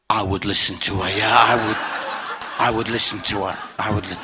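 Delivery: low, understated advice with comic timing; lightly voiced God, deep and matter-of-fact; wry voice of God. God advising a husband about his angry wife, low and wry.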